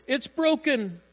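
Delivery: high-pitched comic dad wail; panicking dad. Acted. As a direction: cry out like a helpless father. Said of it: "Dads go".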